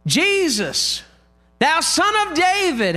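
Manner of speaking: high, drawn-out plea called out; crying out. Retold or imitated. Blind man begging Jesus for mercy, crying out. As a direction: cry out high, stretching the words. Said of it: "then he cries out".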